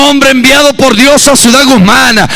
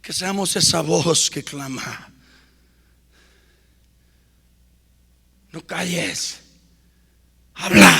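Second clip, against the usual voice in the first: low, soft, near whisper; hushed close; 11 semitones lower, 19 dB quieter than usual. Acted. Preacher closes softly, almost whispering to the church. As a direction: breathe the words to them.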